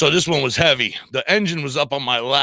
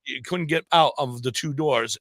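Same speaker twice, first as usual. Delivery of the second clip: ordinary talk, nothing marked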